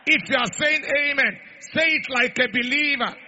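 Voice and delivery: loud, shouted prophetic declaration; full-force preaching. Acted. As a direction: thunder it over the congregation.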